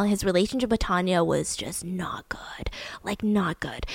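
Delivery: hushed whisper, as if sharing a secret. Real, not acted. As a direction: drop to a confiding whisper. Gossiping about a toxic relationship.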